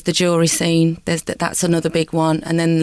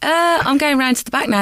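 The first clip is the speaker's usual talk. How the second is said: flustered excuse; 7 semitones higher than usual. Retold or imitated. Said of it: "I went".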